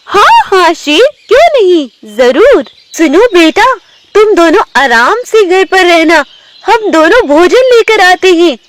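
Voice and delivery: high-pitched tigress voice; agreeable mother tigress. Acted. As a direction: chirp agreement, then instruct the cubs, pitched high. (ऊँची आवाज़ में बाघिन माँ)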